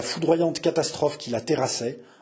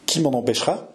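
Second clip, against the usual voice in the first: ordinary delivery, nothing marked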